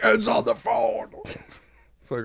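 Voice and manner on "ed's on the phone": high, slow, drawn-out shout; mock excited shout. Host imitating radio hosts announcing a caller, mock excited shout. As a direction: call it out like a hammed-up radio host.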